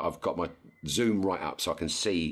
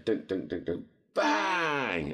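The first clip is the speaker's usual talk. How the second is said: imitated thuds then bang; 4 semitones higher, much slower than usual. Imitating the gun going off.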